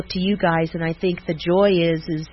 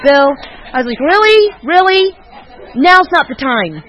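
high, loud exasperated protest; scolding him in disbelief; mock outrage; 8 semitones higher, 6 dB louder than usual. Telling Bill off at dinner, high and exasperated.

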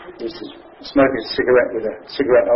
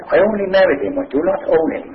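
put-on German-Irish accent; 6 semitones higher than usual. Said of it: "in a German-Irish accent".

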